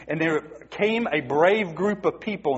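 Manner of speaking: usual speaking voice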